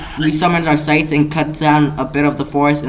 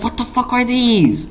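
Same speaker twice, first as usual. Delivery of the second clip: high, drawn-out astonishment; astonished; 8 semitones higher, slower than usual. Acted. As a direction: gape at it in mock disbelief. Naruto acted, astonished.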